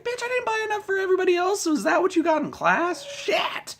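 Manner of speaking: high, fast mock yell; shrill fake shout at a moocher. Mock snap at cigarette moocher.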